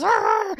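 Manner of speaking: very high squealed roar; kid's mock roar. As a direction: roar back like a small raging child. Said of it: "and the kid goes".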